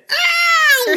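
very high mock shriek; child's wail. Mum mimicking children waking at six, shrieking wail. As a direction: imitate a kid shrieking awake.

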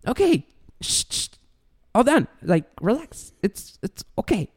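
high-pitched, dismissive hushing; mock soothing with little sympathy; unconcerned shushing. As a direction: brush off a crying child. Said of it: "I don't care. I'm like".

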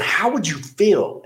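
pushing back at a crying employee; getting agitated. Retold or imitated. Boss confronts struggling salesman, rising agitation. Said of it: "And I was getting a little agitated".

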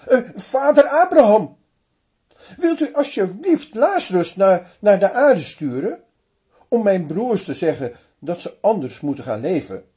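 luid en hoog smekend, wegzakkend; smekend verzoek. Acted. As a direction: vraag dringend om hulp. Rijke man smeekt voor broers. (rijke man smeekt Abraham luid om zijn broers te waarschuwen)

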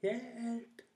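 high, drawn-out cry for help; rop om hjelp. A narrator's reading. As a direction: call out "help" long and high, as if dangling in the air. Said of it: "og ropte". (langtrukkent, lyst rop om hjelp)

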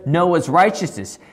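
ordinary talk

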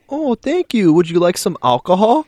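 put-on high, loud old-lady falsetto; imitated old lady. Old lady offers her helper alcohol, high put-on voice. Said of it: "a old elderly lady drops her purse".

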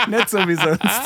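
loud laugh, then words still laughing. Real, not acted. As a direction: burst out laughing and talk through it. Bursts out laughing at a joke.

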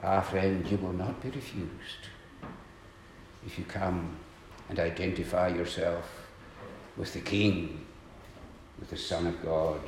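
soft, intimate preacher's assurance; tender reassurance. Real, not acted. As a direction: drop to a hush and comfort them. Preacher offers quiet reassurance.

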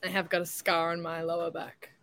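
ordinary delivery

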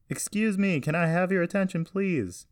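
high, loud call to a crowd; yelling announcement. A narrator's reading. Carson into a microphone to the crowd, yelling. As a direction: yell for everyone's attention. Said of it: "yell into a microphone".

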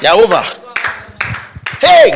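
high and loud, slammed down on over; triumphant shout. Acted. Preacher telling weeping listeners their crying days are over, shouting. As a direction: declare it finished for good.

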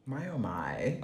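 breathy whisper of disbelief; whispered shock. Real, not acted. Reacts to shocking date story.

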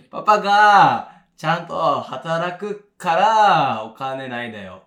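slow, broken Japanese in a mock Korean accent; mock foreign-accented Japanese. Explaining papa's money troubles, slow mock Korean-accented Japanese. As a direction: imitate halting Korean-accented Japanese, drawn out. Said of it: "韓国人なまりの日本語になっちゃった".

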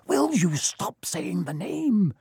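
slow, scared hiss. Acted. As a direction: hiss it in fear. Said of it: "Ron hissed".

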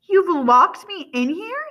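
slow, high, loud incredulous question; disbelief. A narrator's reading. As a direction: ask as if it can't be true. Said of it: "he asks in disbelief".

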